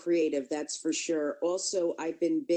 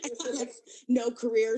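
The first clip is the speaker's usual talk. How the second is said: ordinary delivery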